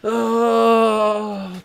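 long, loud, high groan; drawn-out "ohhh" of protest; exasperated groan. Real, not acted. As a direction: groan loudly in dread. Dreads rewatching the film.

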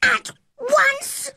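put-on very high, furious child's shriek; shouting. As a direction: shriek the order at her. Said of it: "he shouted".

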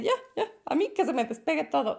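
relieved shake-off. Retold or imitated. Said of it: "me sentí así de".

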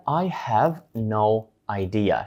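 slow, clear English model; slow model phrase. Shown as a demonstration. Teacher introducing the second phrase slowly. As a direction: lay it out slowly for learners. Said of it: "звучить як".

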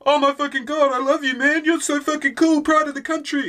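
gushing starstruck fan. Retold or imitated. Fan gushing at Steve Irwin, mock starstruck squeal. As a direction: gush like a starstruck fan meeting his hero. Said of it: "but it's not like".

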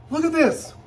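excited high-pitched squeal; delighted squeal. Real, not acted. Reveal of the bag.

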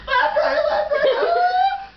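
high, squeaky cadence ending in a yelp; mocking Fish Paul. Retelling a run, mimicking a classmate's cadence. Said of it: "Fish Paul being Fish Paul".

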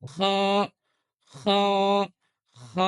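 খ scraped at the back of the throat; throaty kha. Shown as a demonstration. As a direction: recite খ with rough friction. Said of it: "এরকম একটা sound হবে".

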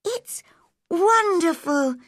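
put-on high-pitched girl's delighted cry; slow, high exclamation of joy; Mary. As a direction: exclaim in wonder, stretching the words. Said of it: "cried Mary".

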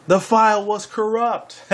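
deadpan punchline cracking up; slow punchline. Acted. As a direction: drag out the punchline. Delivering joke punchline.

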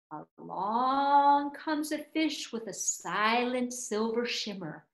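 usual speaking voice

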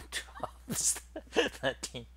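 counting through chuckles; laughing count. Real, not acted. Streamer counting keystrokes for viewers, laughing. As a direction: let a laugh break up the numbers.

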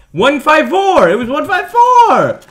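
high, loud exasperated insistence; frustrated outburst. Real, not acted. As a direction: cry out the digits in exasperation. Password rejected, frustrated outburst.

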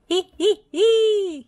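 high, loud hee-hee giggle; mischievous chuckle. A narrator's reading. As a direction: squeal out a spelt-out cackle, last one held. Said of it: "he chuckled".

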